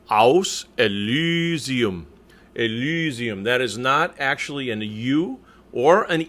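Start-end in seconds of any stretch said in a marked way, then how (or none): 0.1-3.4 "Elysium" slow, with a long German ü; vowel between U and E. Shown as a demonstration. Coach modelling for the choir, "Elysium" with a drawn-out ü. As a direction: draw out the y of "Elysium" as rounded ee. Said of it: "It's a little bit in between".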